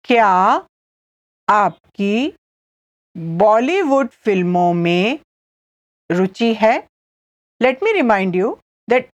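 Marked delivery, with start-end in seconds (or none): 0.1-6.8 slow question in separated chunks; long pauses between words for learners; said slowly. Shown as a demonstration. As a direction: spell it out piece by piece. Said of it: "I'll say it again slowly".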